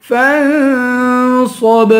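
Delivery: فَانصَبْ with long nasal ن, bounced ب; ikhfa and qalqalah. Shown as a demonstration. Teacher showing the rules, فَانصَبْ slowly nasalised. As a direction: hum into heavy ص in فَانصَبْ, echo the ب. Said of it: "فا پہ اخفا کریں گے".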